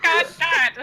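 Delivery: exasperated groan. Retold or imitated. Player retells her frustration at the dice, high and loud. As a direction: cry out to heaven in mock frustration. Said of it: "I was like".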